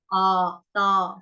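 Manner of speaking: आ and ता held very slowly; long vowel. Shown as a demonstration. Teacher holds the long vowel for students, very slow. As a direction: stretch आ in ता. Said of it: "दीर्घ मात्रा है".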